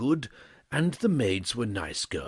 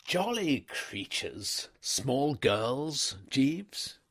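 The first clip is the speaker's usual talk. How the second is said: put-on higher, slow young gentleman; slow, pitched-up upper-class gent; weary young toff; 6 semitones higher, much slower than usual. A narrator's reading. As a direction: put on a brave face about the girls. Wooster after a pause.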